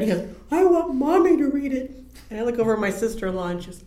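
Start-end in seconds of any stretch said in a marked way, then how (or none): 0.5-1.9 put-on high, loud scared little kid; scared child. Scared nephew asking for his mother instead, loud and high. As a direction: mimic the frightened nephew, much higher and louder. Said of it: "he started getting really scared".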